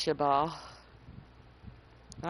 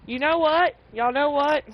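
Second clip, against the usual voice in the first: higher, louder attention grab; loud call to chat; 7 semitones higher, 11 dB louder than usual. Real, not acted. Announcing to chat before a break, loud call.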